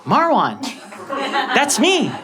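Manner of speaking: mock-proud pointing at the TV; proud reveal. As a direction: present yourself like a celebrity. Showing parents the news.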